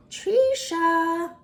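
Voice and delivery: high call across a fair; grandma calling the child from afar. Acted. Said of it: "I heard my bubby call out".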